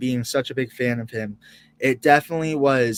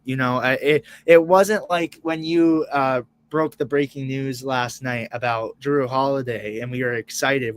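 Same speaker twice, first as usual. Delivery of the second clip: ordinary talk, nothing marked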